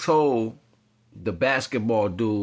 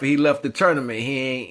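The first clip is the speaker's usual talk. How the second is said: ordinary talk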